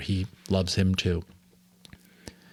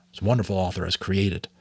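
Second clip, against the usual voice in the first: plain reading voice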